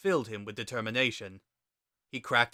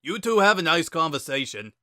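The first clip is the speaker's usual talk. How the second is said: louder, raised voice down the stairs; calling from downstairs; 5 semitones higher, 5 dB louder than usual. Acted. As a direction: call it out across the house. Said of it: "Sans called from downstairs".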